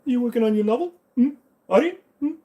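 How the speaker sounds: high-pitched cartoon baby impression; Stewie. Shown as a demonstration. Cartoon baby teasing about a novel, high-pitched. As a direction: needle someone in a high put-on voice. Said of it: "don't really do a good one of Stewie".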